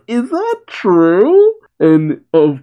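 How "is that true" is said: high, drawn-out amused question; amused Goku. Retold or imitated. Goku laughs at Gas wetting himself, amused and teasing. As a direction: tease him with a high, slow question. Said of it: "Goku laughs and says".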